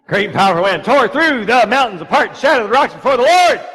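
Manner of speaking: loud, high, dramatic proclamation; big pulpit voice, building on each word; booming preacher reading. Acted. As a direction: thunder the verse out like the storm itself. Rereads verse with full force.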